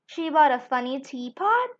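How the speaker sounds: usual speaking voice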